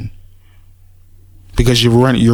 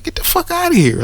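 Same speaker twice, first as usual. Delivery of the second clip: much higher mock brush-off; family dismissing. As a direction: shoo him away in a put-on relative's voice. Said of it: "They'll tell you".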